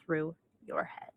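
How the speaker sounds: breathy mock whisper. To the MLM seller, a condescending whisper. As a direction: whisper it like a patronising secret.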